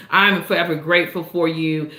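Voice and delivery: ordinary talk, nothing marked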